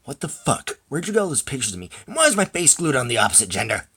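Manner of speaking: indignant shout building in volume; angry shouting. Acted. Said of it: "because you're shouting".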